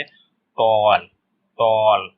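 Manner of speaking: 'टॉल' slowly with a long aw; O sound demo. Shown as a demonstration. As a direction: stretch the vowel in 'टॉल'. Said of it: "ओ का sound आ रहा है ना". ('टॉल' में ऑ को खींचकर बोलें)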